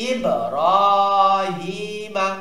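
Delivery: "ইব্রাহিমা" chanted slowly with long held "রা" and "হি"; madd recitation. Shown as a demonstration. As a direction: bounce the b of "ইব্রাহিমা", then stretch the long vowels. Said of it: "আলিফ মাদ".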